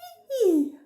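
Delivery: high, loud monkey giggle; monkey chatter. A narrator's reading. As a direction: giggle shrilly like storybook monkeys. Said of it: "said the monkeys".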